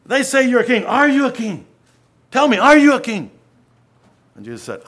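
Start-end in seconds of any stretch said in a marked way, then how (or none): 0.0-3.3 loud, high, exasperated demand; frustrated demand. Acted. Pilate frustrated before Jesus, loud exasperated demand. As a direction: Pilate pressing hard, fed up with the silent prisoner. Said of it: "And he, so frustrated, says".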